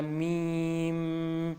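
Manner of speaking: slow میم with a long closing hum; stretched. Shown as a demonstration. Teacher showing learners how it is read, long میم. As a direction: draw out میم, "meeemmm". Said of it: "تھوڑا کھینچ کے پڑھنا ہے".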